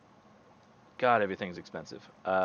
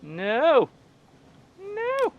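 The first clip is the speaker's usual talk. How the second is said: anguished cry; 5 dB louder than usual. Real, not acted. Ship under attack.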